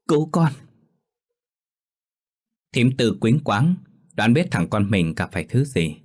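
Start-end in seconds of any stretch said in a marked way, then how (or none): 0.1-0.5 terrified plea. Acted. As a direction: keep begging mother in terror. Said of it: "miệng mếu máo khóc".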